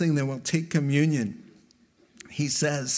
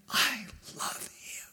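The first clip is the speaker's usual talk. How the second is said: imitating God in a hushed whisper; dramatic whisper; 4 semitones higher than usual. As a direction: whisper it with weight, pausing between phrases. God's tender words, acted in whisper.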